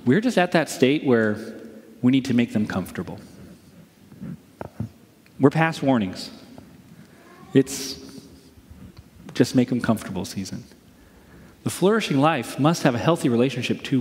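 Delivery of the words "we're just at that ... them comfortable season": low, subdued doctor; doctor without warnings. Acted. Doctor saying they are past warnings, low and subdued. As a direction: break it gently, in a low resigned voice. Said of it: "doctor who's not giving a warning says something like this".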